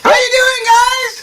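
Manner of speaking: very high, cheery character voice; greeting Tom. Acted. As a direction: call out high to the kids.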